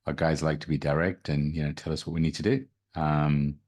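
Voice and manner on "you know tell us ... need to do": ordinary delivery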